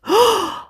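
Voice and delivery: a quick surprised intake of breath; short startled gasp; sharp gasp. A narrator's reading. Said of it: "she gasped".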